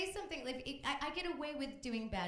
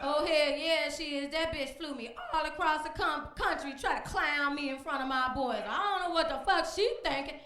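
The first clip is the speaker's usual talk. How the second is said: louder, higher boastful guy; loud high brash man voice; 7 semitones higher, 9 dB louder than usual. Acted. A cocky defendant ranting about the woman who sued him.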